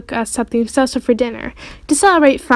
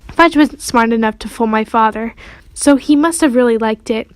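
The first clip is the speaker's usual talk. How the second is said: plain reading voice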